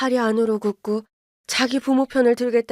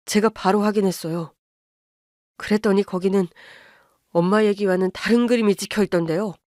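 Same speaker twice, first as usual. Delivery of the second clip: apologetic son-in-law. Acted. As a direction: hold it down, sorry but firm. Son voice again.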